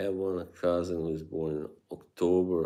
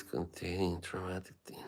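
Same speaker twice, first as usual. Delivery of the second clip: whisper; 11 dB quieter than usual. Acted. Joking warning label aside.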